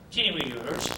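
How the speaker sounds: ordinary talk, nothing marked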